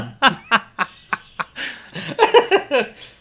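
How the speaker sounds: high drawn-out laughter; long loud laugh. Real, not acted. Cohost laughing at the mock sexy line. Laughing at butt cheek quote.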